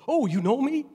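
delighted disbelief; surprised. Retold or imitated. Pastor surprised the officer recognizes him, high.

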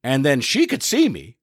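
ordinary talk, nothing marked

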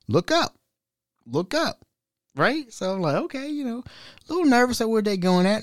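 high, soft nervous rambling; mock nervous. Answering the scammer, he nervously rambles about recipes. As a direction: ramble on anxiously in a high small voice.

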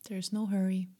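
plain reading voice

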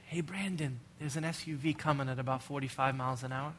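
gently mock how timid that would be; mock gentle tap. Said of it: "tap that boy lightly on the shoulder".

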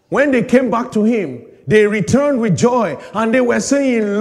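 loud, high, driving sermon voice; full-force preaching. Acted. Preacher rousing the congregation about pride, full force.

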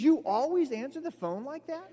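high, disbelieving repeat of the words; incredulous question. Retold or imitated. Roommate on the phone, baffled by the greeting, incredulous. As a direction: echo it back in disbelief.

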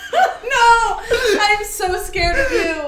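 high, loud cry through laughter; laughing protest. Real, not acted. Friend hearing storyteller's outrageous lie, laughing high protest. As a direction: protest helplessly while cracking up.

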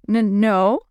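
hesitant stuttered denial; stammering. A narrator's reading. Willie answers the taunting boys, stammering. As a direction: stumble on the first sound, then give in. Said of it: "Willie stammered".

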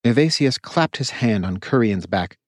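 usual speaking voice

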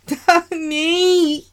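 high anguished call of his name; crying out. Acted. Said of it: "I cried out".

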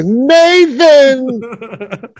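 high, slow mimicked cry; drawn-out holler. Joining in his friend's trailer scream, hollered long and high. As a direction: stretch the name into a long high yell.

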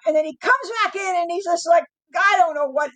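ordinary delivery

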